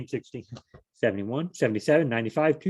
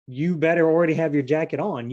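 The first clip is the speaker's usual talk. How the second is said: normal talking voice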